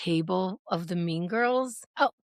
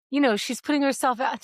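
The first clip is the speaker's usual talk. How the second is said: ordinary talk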